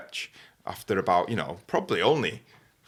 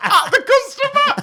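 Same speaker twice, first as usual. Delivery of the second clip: very high, loud laughing fit; helpless laughter; 10 dB louder than usual. Real, not acted. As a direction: squeal the words out through real laughter. Cracking up at own story.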